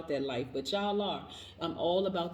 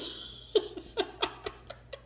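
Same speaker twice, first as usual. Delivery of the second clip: high laugh; 4 dB quieter than usual. Real, not acted. After screaming affirmation.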